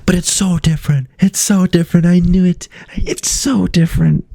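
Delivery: very high, mock-excited squeak; high squeaky voice. Repeated high squeaky exclamation.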